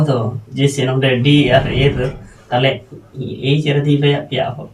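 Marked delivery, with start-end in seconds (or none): none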